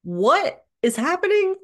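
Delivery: stunned as if you no longer know her; incredulous. Retold or imitated. Daughter reacting to her mother's sudden healthy habits, incredulous. Mom suddenly quits drinking, exercises.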